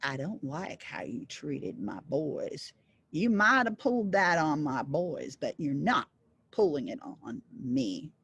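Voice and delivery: a hint of a menacing father devil; stern big devil. Big devil tells John he won't be tricked, low and grim.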